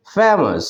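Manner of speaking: Famous with ফে pressed, then falling; careful model of famous, ফে stressed; stressed syllable demo. Shown as a demonstration. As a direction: lean on the first syllable of famous. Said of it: "ঠিক একইভাবে".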